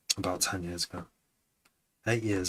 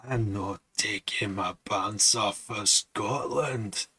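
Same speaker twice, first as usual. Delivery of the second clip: put-on very slow, laboured Scottish accent; bad Scottish accent; much slower than usual. Streamer retrying a movie line, bad Scottish accent. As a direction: stretch every word in an amateur Scottish accent. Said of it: "I can't do a Scottish accent".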